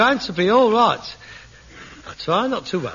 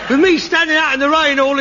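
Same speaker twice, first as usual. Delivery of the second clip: shouting back; 7 semitones higher, 4 dB louder than usual. Acted. Son yells back at father.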